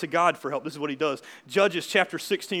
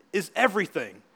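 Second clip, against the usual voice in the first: normal talking voice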